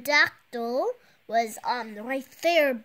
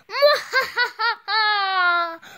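loud high gloating villain laugh; 8 semitones higher, 6 dB louder than usual. Acted. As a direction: cackle like a villain. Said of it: "Romeo laughs".

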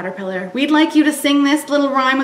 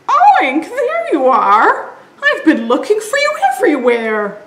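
higher relieved oinking mother pig; mama pig voice; 7 semitones higher, 5 dB louder than usual. Acted. Mother pig finding piglet, higher relieved. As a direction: greet your lost baby. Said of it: "said Mama".

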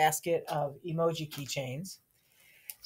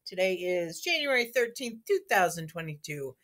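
announcer; 6 semitones higher, 4 dB louder than usual. Acted. School morning announcement.

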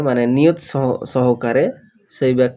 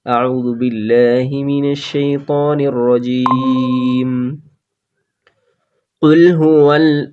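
Quran recitation; much slower than usual. Shown as a demonstration. Teacher reciting the verses for students to follow, slow chant. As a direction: chant each word slowly, stretching the vowels.

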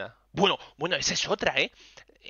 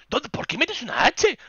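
much higher, slow, loud disbelieving question; exasperated outburst; 12 semitones higher, 6 dB louder than usual. Real, not acted. Streamer rants to chat about the extra letter, high and exasperated.

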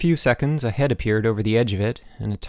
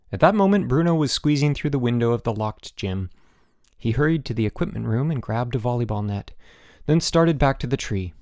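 ordinary delivery

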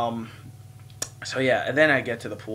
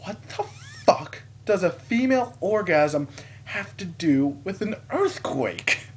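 high, slow, drawn-out disbelief; baffled outburst; 7 semitones higher, slower than usual. Retold or imitated. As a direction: stretch out the bewildered question. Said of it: "I, first thought was".

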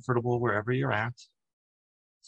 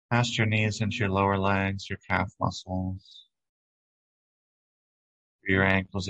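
calm, even meditation voice with long pauses; unhurried, soothing relaxation lead; guided meditation. Acted. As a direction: guide them gently through breath and body, leaving room between phrases.

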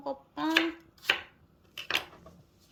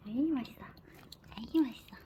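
whispering; much faster than usual. Real, not acted. Whispered praise to dog.

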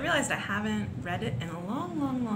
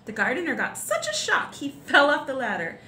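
plain reading voice

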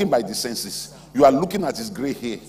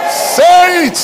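high, booming shouted command; belted high pulpit cry; full-force preaching shout; 16 dB louder than usual. Real, not acted. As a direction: shout it out to a whole congregation. Preacher calls congregation to repeat.

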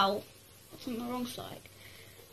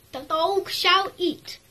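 slow and louder, pitched higher; grand mock decree; 5 semitones higher, 4 dB louder, slower than usual. Announcing the dog's meal in a grand mock herald voice. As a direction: proclaim it like a herald.

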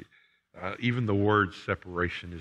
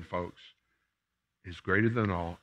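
usual speaking voice

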